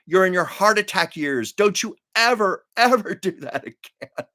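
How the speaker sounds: mock-scold like an alarmed sister; imitated scolding sister. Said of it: "my sister contacted me, said".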